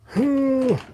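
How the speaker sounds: high sigh. Acted. Roboto sighs after rescue.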